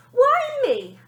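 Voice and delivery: high, loud aggrieved whine; hard done by. A narrator's reading. Girl whining at the nanny, high and loud. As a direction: complain it's so unfair. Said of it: "sounding very hard done by".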